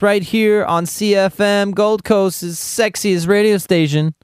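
slow, showy station-promo read; radio announcer voice. Pretend. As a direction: sell the station like a radio jingle. Radio slogan read, slowed and showy.

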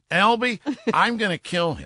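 hammed-up anger at the prankster; mock fury. Joe realizing the prank.

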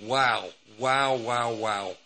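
awed, breathless wows; mesmerized wow. Retold or imitated. Recalling his first night in Las Vegas, mesmerized and awed. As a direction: let each wow hang in wonder. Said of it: "I'm just mesmerized".

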